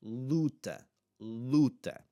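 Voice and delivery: luta with a long, stressed 'oo'; open U sound. Shown as a demonstration. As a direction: model 'luta' slowly as LOO-ta. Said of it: "gonna have the open sound".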